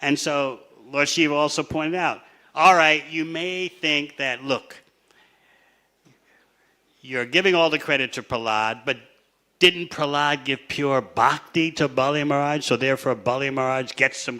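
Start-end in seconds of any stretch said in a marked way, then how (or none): none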